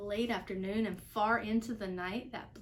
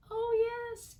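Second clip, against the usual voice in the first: very high, louder piping; piping Who voice; 13 semitones higher, 5 dB louder than usual. A narrator's reading. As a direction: pipe up tiny and eager. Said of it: "piped the voice".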